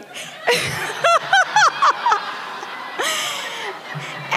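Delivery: long high laugh at the joke; a long amused laugh. Real, not acted. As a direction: let a real laugh roll out. Laughing at the kids' table joke.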